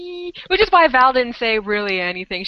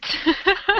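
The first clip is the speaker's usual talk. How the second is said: high laugh; 9 semitones higher than usual. Real, not acted. Laugh after no you do not.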